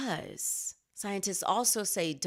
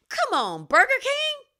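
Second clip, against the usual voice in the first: loud, much higher shout of disbelief; exasperated shout; 10 dB louder than usual. Acted. Host scolding Burger King over the ad, incredulous shout. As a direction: yell it out, fed up. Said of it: "It makes me say".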